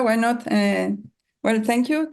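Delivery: ordinary talk, nothing marked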